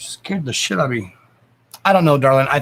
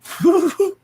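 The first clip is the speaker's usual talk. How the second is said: sudden high squeal of a laugh; real high laugh; high burst of laughter. Real, not acted. Laugh heard.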